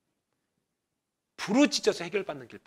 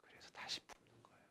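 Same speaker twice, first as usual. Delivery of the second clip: whisper; 26 dB quieter than usual. Real, not acted. Quiet aside in sermon.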